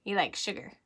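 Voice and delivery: usual speaking voice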